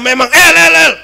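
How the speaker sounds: high, loud imitation of the friend; loud, hard tone. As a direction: shout the name like a rough friend. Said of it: "Memang dia nadanya keras". (nada keras, berteriak lantang)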